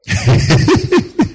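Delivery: high, rapid chuckle; real chuckle. Real, not acted. Preacher amused at money chasers, chuckling. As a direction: break into a quick rippling laugh. Preacher laughs at forgetful members.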